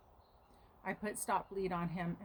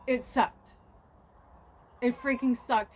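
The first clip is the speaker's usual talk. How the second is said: real, loud and higher-pitched venting; frustrated outburst; 4 semitones higher, 9 dB louder than usual. Real, not acted. Owner venting about her goat's injury, a loud frustrated outburst. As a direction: let the frustration burst out loud.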